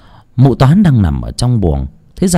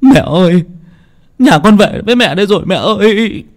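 high, wailing cry to a dead mother; keen it out, voice pitched high with grief; a lightly acted son's lament, higher; 9 semitones higher, 3 dB louder than usual. Son returns wailing to dead mother.

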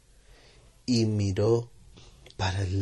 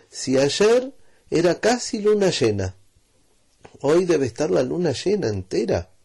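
lightly voiced father; 6 semitones higher, 4 dB louder than usual. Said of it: "dijo el papá".

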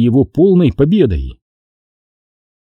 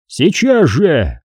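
drawn-out, higher urgent command; hissed command; 4 semitones higher, much slower than usual. A narrator's reading. Xanatos to Bruck, demanding instant obedience, hissing. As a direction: demand it slowly and insistently. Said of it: "прошипел Ксанатос Бруку".

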